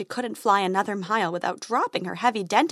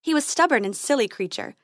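usual speaking voice

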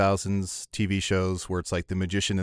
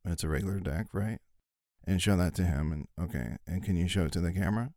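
underplay it as a quiet, deadpan street magician; David Blaine impression; 2 semitones lower, 5 dB quieter than usual. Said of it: "here's my impression".

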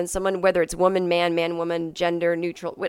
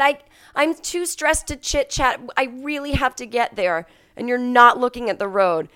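stressed pleading; 5 semitones higher than usual. Retold or imitated. Stressed passenger pleading with driver.

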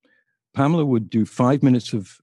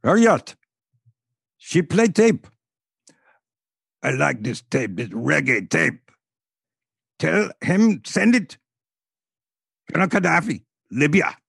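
Gaddafi voice; 5 semitones higher, slower than usual. Retold or imitated. Gaddafi signing off the call to the host, hammed-up commanding foreign voice. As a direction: order it sent like a commanding dictator.

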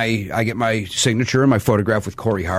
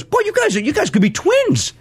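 raised gushing quote of the handler; handler's gushing voice; 14 semitones higher than usual. Retold or imitated. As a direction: gush like an eager handler.

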